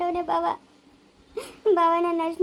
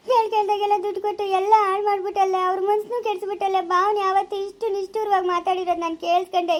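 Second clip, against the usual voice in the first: wailing, higher tearful accusation; crying reproach; 3 semitones higher than usual. Acted. Wife cries and blames mother-in-law for ruining their life. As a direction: sob out the blame.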